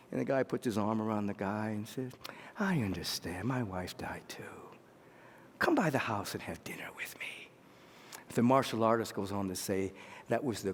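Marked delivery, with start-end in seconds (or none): 2.6-7.5 low, soft, near-whispered sympathy; gentle consolation. Acted. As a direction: console him in a hushed, low voice. Voices consoling man, arm around him.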